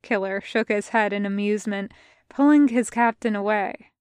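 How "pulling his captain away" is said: ordinary delivery, nothing marked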